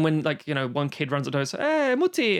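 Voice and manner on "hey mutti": put-on much higher, bright kid's call to his mum; a child running to its mother, calling out. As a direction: squeak it out like a small child. Kid runs up to mother.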